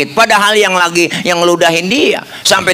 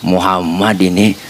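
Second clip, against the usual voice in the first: low, calm voice of the Prophet; lightly voiced, humble and low; gentle Prophet; 8 semitones lower than usual. Prophet answers sick man. (suara Nabi yang rendah dan tenang)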